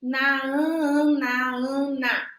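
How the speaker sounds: sounding out "ma, an", slow and clear; syllables swapped aloud. Shown as a demonstration. Teacher shows children how "ma" flips into "an". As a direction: play with the sounds "ma" and "an", one by one. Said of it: "mexer com os fonemas".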